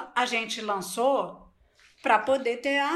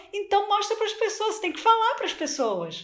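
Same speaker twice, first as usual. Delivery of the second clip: higher insistent coach; mild imitation of a mentor pressing the point; lightly voiced coach; 9 semitones higher than usual. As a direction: urge it, pitched up. Coach urging, retold.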